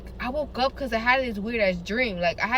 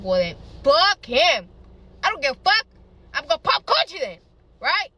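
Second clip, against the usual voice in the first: loud, raised, emphatic retelling; defiant dismissal; 4 semitones higher, 6 dB louder than usual. Retold or imitated. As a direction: throw it out like you are done with him. Acted defiant decision.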